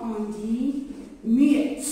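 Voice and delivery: normal talking voice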